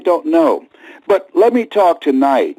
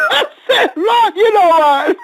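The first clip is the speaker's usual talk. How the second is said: crying; 7 semitones higher than usual. Real, not acted. Retells praying, breaking down.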